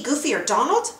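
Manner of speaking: usual speaking voice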